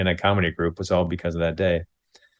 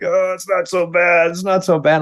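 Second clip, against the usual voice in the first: pained denial; 8 semitones higher than usual. Retold or imitated. Friend rolling in pain, downplaying.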